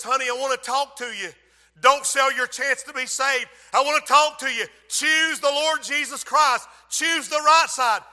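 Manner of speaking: loud pleading, slowing on the end; earnest pastoral appeal; preacher's plea. Acted. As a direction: urge her to choose Jesus.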